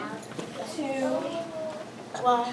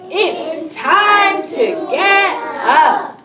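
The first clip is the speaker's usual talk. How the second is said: high, loud, drawn-out wake-up call; storybook shout; 6 semitones higher, 8 dB louder, much slower than usual. Acted. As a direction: call out slowly to rouse everyone. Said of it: "shouted Missus Brown".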